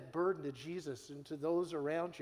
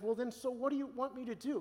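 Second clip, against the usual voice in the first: higher, bewildered asking; mock self pleading; 6 semitones higher than usual. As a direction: lightly play your flustered younger self. Pastor mimics his own pleading.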